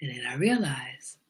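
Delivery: usual speaking voice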